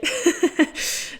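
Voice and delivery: a sudden high unvoiced laugh. Real, not acted. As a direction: burst into a breathy laugh. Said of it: "une belle petite libération".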